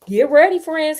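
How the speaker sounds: rally the troops with a light shout; lightly voiced elephant calling friends; mild shout. A narrator's reading. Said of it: "he shouted".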